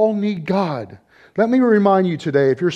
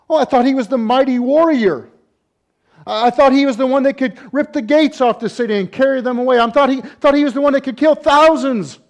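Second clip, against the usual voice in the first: higher, louder preaching, rushing; fiery preaching; 10 semitones higher, 6 dB louder than usual. Acted. Preacher to congregation about the fallen warrior, loud irony. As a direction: drive home the irony. Preacher mocking Samson's former pride.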